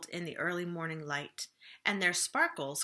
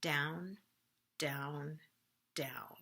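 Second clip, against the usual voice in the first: each down lower and softer; fading downward; 4 semitones lower, 6 dB quieter, much slower than usual. A narrator's reading. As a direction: let each down sink deeper and quieter. Ship sinking, voice sinking lower.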